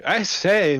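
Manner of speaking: high, loud gentlemanly protest; indignant exclamation. Acted. In-character exclamation to Mister Isaac.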